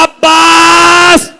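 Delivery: very slow, high, loud wail; weeping cry. Acted. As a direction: cry out to Abbas through sobs. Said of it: "रो के कहा".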